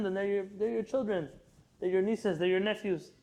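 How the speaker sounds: ordinary delivery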